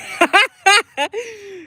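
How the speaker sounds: high, bright real laugh; laughing at her own stubbornness; high burst of laughter. Real, not acted. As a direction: break into a laugh at yourself.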